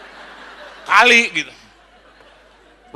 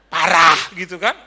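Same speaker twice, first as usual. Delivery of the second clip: usual speaking voice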